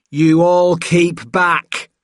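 high, loud, drawn-out command; shouted. A narrator's reading. Jed to the attackers beside Richard, shouting. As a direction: shout a slow warning to the crowd. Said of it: "Jed shouted".

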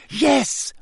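high, indignant burst; heated, high-pitched agreement. A narrator's reading. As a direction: snap back with feeling. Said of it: "in a heated voice".

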